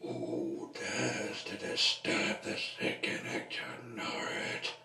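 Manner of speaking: rasping stage whisper; voiceless, whispered character line; whispery character voice. Acted. Another figure speaks in whispery voice.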